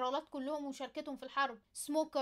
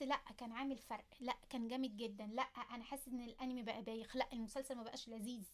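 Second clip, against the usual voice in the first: softer, lower, let-down murmur; disappointed viewer; 4 semitones lower, 7 dB quieter than usual. Retold or imitated. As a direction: sigh it like a bored fan. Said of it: "بتحس".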